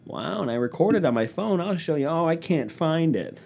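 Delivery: high, awed mock gushing; mock impressed crowd. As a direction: gush like a dazzled fan, dragging it out. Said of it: "everyone's gonna be like".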